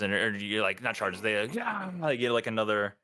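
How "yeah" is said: crowd roar. Acted. Game master voices the crowd answering the challenge, mock roar. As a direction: imitate a roaring crowd. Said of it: "roar of from, from the crowd behind".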